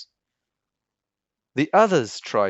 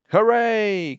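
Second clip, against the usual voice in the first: high, loud whoop of joy; joyful cheer; 11 semitones higher, 6 dB louder than usual. Acted. Pearl cheers as the king sets her free. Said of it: "cried Pearl".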